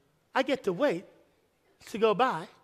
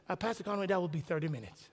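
much softer, with a laugh; soft polite waitress; 4 semitones lower, 12 dB quieter than usual. Retold or imitated. Waitress tells the pastor the wait, soft and polite. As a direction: break the news gently. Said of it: "She says".

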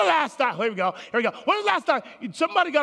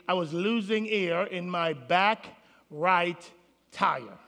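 ordinary delivery

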